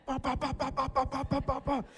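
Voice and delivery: ba ba ba punch sounds, high and fast; high rapid punch noises. Retold or imitated. Imitating his own punches in the fight, high punch noises.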